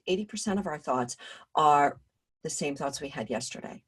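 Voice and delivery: usual speaking voice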